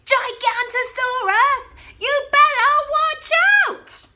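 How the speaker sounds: high, loud alarm call; shout. Acted. Bonehead shouting a fake alarm at his friends, high and loud. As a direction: yell the warning across the hill. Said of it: "they heard a shout".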